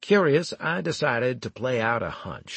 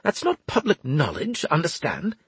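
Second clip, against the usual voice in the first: high, strained angry hiss; urgent half-whispered scolding, pitched high; 8 semitones higher than usual. A narrator's reading. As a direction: hiss a furtive warning. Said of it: "Winslade began hissing at me".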